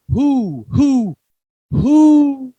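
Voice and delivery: owl hoots. Acted. Reading to a child, the owl hoots rising.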